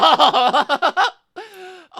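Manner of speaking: big loud burst of laughter. Real, not acted. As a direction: crack up loudly.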